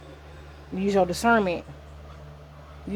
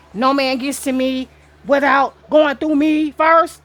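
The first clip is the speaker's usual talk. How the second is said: loud, slow and higher proclamation; raised-voice, drawn-out pronouncement; declamatory quote; 5 semitones higher, 7 dB louder, slower than usual. Acted. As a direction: boom it out like a solemn decree. Mock-quotes a gatekeeping claim.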